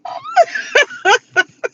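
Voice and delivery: high crying laugh; shrieking laugh. Real, not acted. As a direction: laugh until it squeals. Laughing at own joke.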